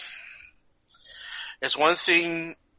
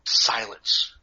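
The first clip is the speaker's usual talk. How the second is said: hushed, breathy whisper; mock classroom hush; whispered silence; 4 semitones lower than usual. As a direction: whisper it like nobody dares speak. Kids silent.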